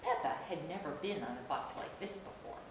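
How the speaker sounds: ordinary delivery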